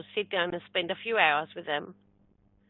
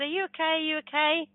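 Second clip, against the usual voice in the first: retold worried check, pitched up; a touch of alarm, higher voice; mildly alarmed concern; 7 semitones higher than usual. Retold man runs out, worried.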